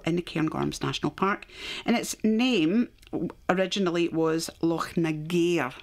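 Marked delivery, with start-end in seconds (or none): 4.6-5.7 "Nagair" ending in gair, rhyming with air; original pronunciation. Shown as a demonstration. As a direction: stress the last syllable of "Nagair", na-GAIR. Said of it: "it's got that pronunciation on the ga".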